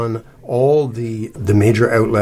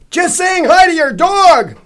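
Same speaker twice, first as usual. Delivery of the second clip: very high, drawn-out loud yell; bellow; 9 dB louder, slower than usual. A narrator's reading. Zal to the stout lady over the TV, bellowing. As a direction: bellow it slowly for someone who can't hear. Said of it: "Zal bellowed, probably in relief".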